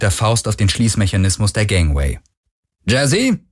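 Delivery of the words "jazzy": raised questioning summons; called out. A narrator's reading. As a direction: call for the computer, lifting the pitch. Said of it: "rief sie". (fragend gerufen)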